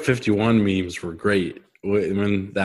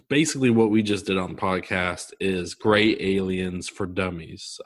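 ordinary talk

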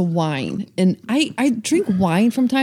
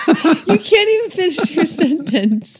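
laughing; 8 semitones higher than usual. Real, not acted. Laughing at partner.

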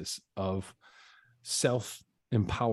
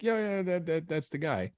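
high, quick-fire imitation of bystanders; eager informants; 8 semitones higher than usual. Inmates telling him who stole his stuff, high and eager. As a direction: imitate people eagerly pointing someone out. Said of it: "People immediately were like".

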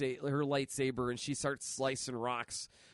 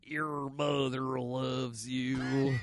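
Sam Elliott impression; much slower than usual. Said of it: "I like your Sam Elliott impression".

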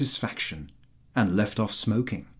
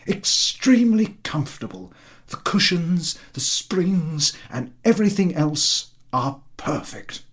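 lightly voiced fawning landowner, higher; eager flatterer; 7 semitones higher, 4 dB louder than usual. A narrator's reading. Chertakutski flattering the general about his horse, eager and higher. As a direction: fawn over the horse in a raised, eager voice.

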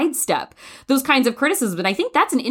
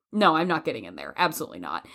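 firm refusal; 5 semitones lower, 6 dB quieter, faster than usual. Retold or imitated.

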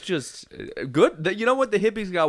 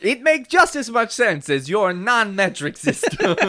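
mock Eastern European; 8 semitones higher, 4 dB louder than usual. Continues bit.

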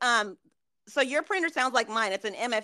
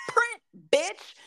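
higher-pitched, punchy order; exasperated command; 7 semitones higher than usual. Shown as a demonstration. Worker snaps at a stubborn printer, high and exasperated. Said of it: "So I'm like".

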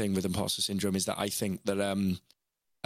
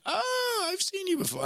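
much higher, sing-song mock of a dismissive stranger; mocking imitation. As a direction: squeak it out as a snooty put-down. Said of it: "And they're like".

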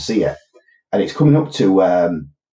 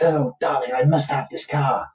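higher, slow, put-on posh woman voice; mock posh English lady; 6 semitones higher than usual. Woman in antique shop demanding the car, hammed-up posh voice. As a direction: play the plummy wife drawing out her demand. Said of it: "and the woman's like".

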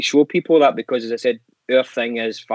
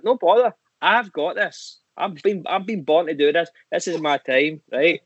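imitating dad's self-assured reply, pitched up; cocky dad; 5 semitones higher than usual. Dad brushing off the tour manager's instructions, cocky. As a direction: play a dad who's born for the stage.

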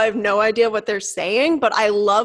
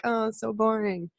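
mock complaint, dropped softer; bored complaint; 6 dB quieter than usual. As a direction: grumble it quietly, imitating a bored dieter. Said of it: "instead of complaining about".

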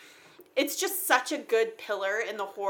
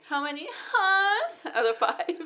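laughing; 9 semitones higher, slower than usual. Real, not acted. Joking rating question while laughing.

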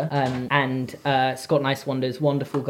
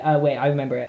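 normal talking voice

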